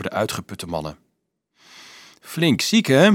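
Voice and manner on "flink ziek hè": higher, pleased little question; mildly smug aside; satisfied mumble. A narrator's reading. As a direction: remark on it with satisfaction. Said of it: "mompelde hij met voldoening".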